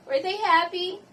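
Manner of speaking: loud, high question climbing on "happy"; rising question intonation. Shown as a demonstration. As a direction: run it together, pitch soaring to "happy". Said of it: "the intonation will rise at the end".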